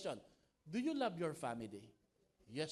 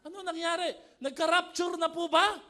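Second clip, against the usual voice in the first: mock alarm; 11 semitones higher, 12 dB louder than usual. Joking about silent family.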